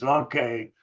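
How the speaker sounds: retold grudging agreement; begrudging. Seller accepting eight dollars from a kid, begrudging. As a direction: give in reluctantly, as the seller.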